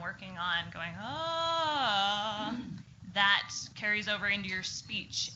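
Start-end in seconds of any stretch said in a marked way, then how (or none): 1.0-2.5 pitch glide. Shown as a demonstration. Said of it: "your pitch go up and down".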